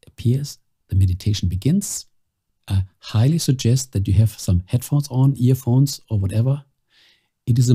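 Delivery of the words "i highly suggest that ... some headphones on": ordinary talk, nothing marked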